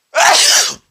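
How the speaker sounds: loud explosive sneeze. Real, not acted. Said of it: "I just sneezed again".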